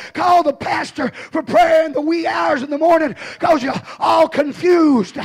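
loud, high, rising sermon shout; full-force preaching. Acted. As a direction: preach it hard to the congregation.

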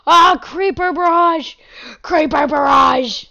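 loud mock battle cry, second call drawn out; alarmed shout. Gamer spots creepers attacking, loud alarmed shout. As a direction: yell the warning at full volume.

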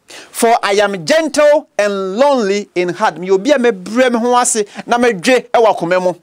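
ordinary talk, nothing marked

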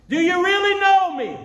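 crying out to a temple crowd; high, booming challenge with no microphone; loud voice. Acted. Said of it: "He said in a loud voice".

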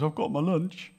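high, put-on lad's voice; young lad. Lad offering food to the disciples, young lad's voice. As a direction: pipe up like an eager small boy.

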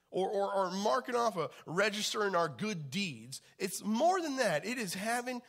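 loud, high, impassioned preaching; preaching at full force. Acted. Pastor urging the church, loud and impassioned. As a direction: drive it home to the congregation.

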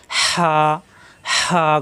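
হা with a strong rasp of air from mid-throat, then a held vowel; hot-air throat sound. Shown as a demonstration. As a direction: squeeze mid-throat and push হা out on warm air. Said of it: "গরম বাতাস বের হবে". (গলার মাঝখান থেকে গরম বাতাসে টানা হা)

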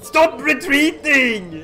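high, drawn-out yell; exasperated order. Pretend. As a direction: call out to fleeing troops, exasperated. Yelling at fleeing troops.

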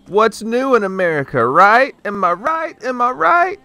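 high rising "Am I right?"; sarcastic, climbing "right?". Retold or imitated. Mocking hick catchphrase.

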